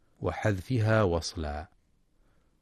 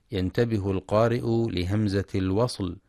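ordinary talk, nothing marked

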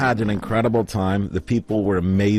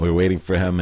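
usual speaking voice

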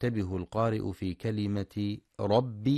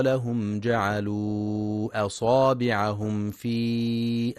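slow, louder melodic tajweed chant; Quran recitation; 2 semitones higher, 6 dB louder, slower than usual. Acted. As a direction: chant the verse with long held vowels.

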